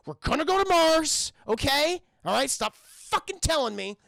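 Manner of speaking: loud, high acted outburst; angry yelling. Man yells at his partner about Mars, acted angry shout. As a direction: snap back at full voice, fed up. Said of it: "Don't yell at me".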